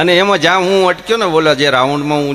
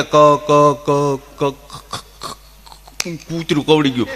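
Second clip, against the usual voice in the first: mock stammer; slower than usual. Stammerer tells of dog bite.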